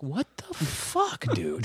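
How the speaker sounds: high, soft, drawn-out disbelief; slow high squeak of exasperation, dropping on the last word; baffled exclamation. Retold or imitated. Son reacting to dad's cryptic talk, in mock bafflement.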